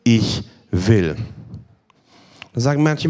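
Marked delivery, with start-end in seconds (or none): 0.0-1.1 low, emphatic ICH WILL; deep, deliberate "Ich will"; said clearly. Shown as a demonstration. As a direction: plant "Ich will" low and firm, each word weighted. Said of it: "Jesus sagt es deutlich".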